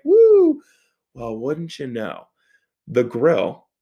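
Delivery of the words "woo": high, loud "woo"; excited whoop. Retold or imitated. Driver celebrating the short trip ahead, whooping.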